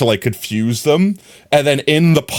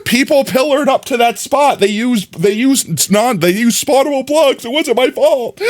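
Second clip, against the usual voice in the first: defensive protest; 11 semitones higher than usual. Real, not acted. Defending herself, laughing.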